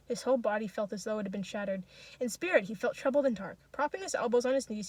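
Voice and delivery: ordinary delivery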